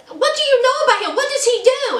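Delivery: high, fast, disbelieving questions; shocked friends. Retold or imitated. Friends grilling Lisa about her Nigerian boyfriend, high fast disbelief.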